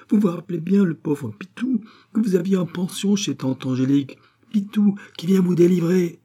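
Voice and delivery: high-pitched eager pleading to be remembered; excited, eager. A narrator's reading. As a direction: gush to an old acquaintance you came to save. Pitou eagerly reminds doctor who he is.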